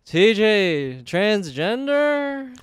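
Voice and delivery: high, very drawn-out punchline; exaggerated, soaring comic question. Shown as a demonstration. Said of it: "that sort of energy, you know".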